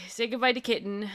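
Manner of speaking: usual speaking voice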